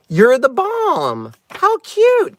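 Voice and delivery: delighted exclamation. Real, not acted. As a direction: coo over a card, slowly. Exclaims over front of card.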